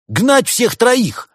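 high, loud, very slow rallying cry; drawn-out enthusiastic shout; carried away. A narrator's reading. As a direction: propose it with rousing zeal. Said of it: "увлечённо предложил антиквар".

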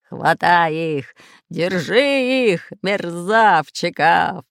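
slow, loud pirate growl; Бульбуль growling. A narrator's reading. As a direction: growl orders like a red-bearded pirate. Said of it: "рычал рыжебородый пират Бульбуль". (пиратский рык, протяжно)

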